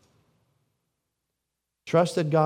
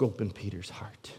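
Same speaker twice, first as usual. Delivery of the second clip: hushed whisper after a long pause; whispered line about a broken heart; 7 semitones lower, 12 dB quieter than usual. Real, not acted. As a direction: breathe it out as a secret. Hushed moment in the sermon.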